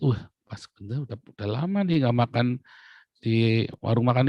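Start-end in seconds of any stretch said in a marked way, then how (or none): none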